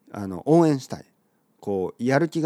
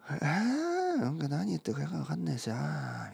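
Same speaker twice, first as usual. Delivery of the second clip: mumbled muttering; 6 semitones higher, 6 dB quieter than usual. Shown as a demonstration. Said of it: "みたいな。".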